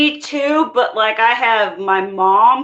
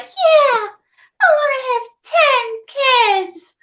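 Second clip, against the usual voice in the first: much higher, bright eager voice; naive younger self; 12 semitones higher than usual. Retold or imitated. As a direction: play her gushing childhood self. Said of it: "I'd be like".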